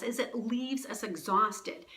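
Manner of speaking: usual speaking voice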